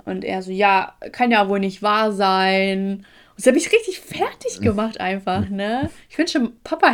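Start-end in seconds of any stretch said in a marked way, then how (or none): 0.5-3.0 very drawn-out, louder mimicry; mega slow phone talker. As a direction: drag out every word to torture the listener. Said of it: "mega langsam am Telefon".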